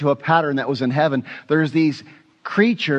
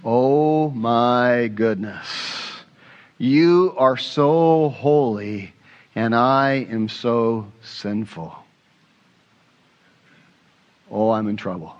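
awestruck dread; 4 semitones lower, slower than usual. Retold or imitated. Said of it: "you know what you're gonna say?".

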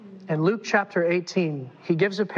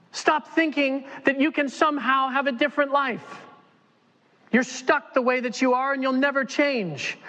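high, sneering accusing voice; voice of the enemy; 8 semitones higher than usual. Acted. The enemy silencing the sinner, high sneering taunt. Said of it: "here is the work of the enemy".

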